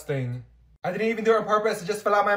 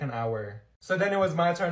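ordinary delivery

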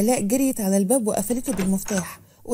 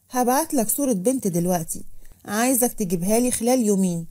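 plain reading voice

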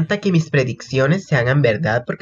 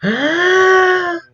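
delighted shout. Real, not acted. Fan screaming at the kiss, high held scream.

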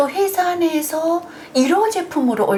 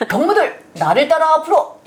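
loud, high rallying cry; rally the comrades like a propaganda agitator; North Korean agitator; 5 semitones higher, 4 dB louder than usual. Pretend. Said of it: "저는 요것밖에 못합니다".

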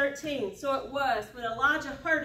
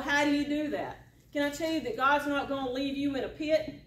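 ordinary talk, nothing marked

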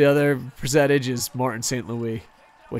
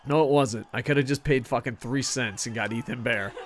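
usual speaking voice

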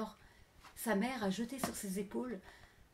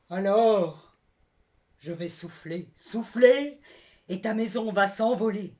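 threatening wolf; 11 dB louder, slower than usual. Acted.

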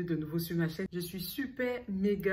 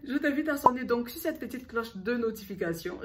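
louder and higher, insisting; emphatic repeat; 5 semitones higher, 5 dB louder than usual. Shown as a demonstration. Vlogger urging viewers to ring the bell, louder and insistent. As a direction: push the invitation to ring the bell hard. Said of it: "repeats invitation more emphatically".

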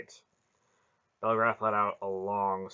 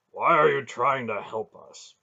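loud, higher, blunt challenge; 4 semitones higher, 11 dB louder than usual. A narrator's reading. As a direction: ask it point-blank and forcefully. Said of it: "Beric asked bluntly".

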